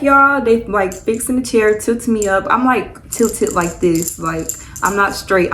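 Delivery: ordinary talk, nothing marked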